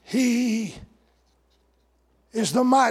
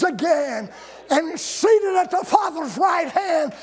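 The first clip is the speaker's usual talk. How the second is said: full-force preaching; 6 semitones higher, slower than usual. Acted. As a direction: preach it out with triumph.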